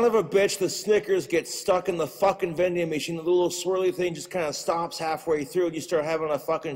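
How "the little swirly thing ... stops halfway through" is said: usual speaking voice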